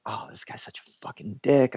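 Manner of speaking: hushed whisper, breathy and secret; whispered gripe. Retold or imitated. Coworker whispering a complaint to the intern, hushed. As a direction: lean in and whisper the insult.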